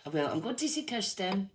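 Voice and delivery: ordinary talk, nothing marked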